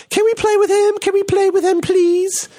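put-on very high, pleading child voice; little kids begging. Host mimicking the British kids begging to play, very high. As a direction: squeak it out like eager kids. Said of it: "the little kids were like".

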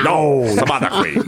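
playful imitation of an imperious drag queen; Vivacious. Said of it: "you have Vivacious's voice down".

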